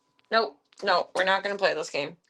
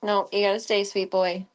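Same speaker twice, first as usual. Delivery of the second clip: ordinary delivery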